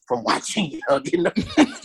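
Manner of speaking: laughing through the words. Real, not acted. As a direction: giggle through the punchline. Repeats punchline while laughing.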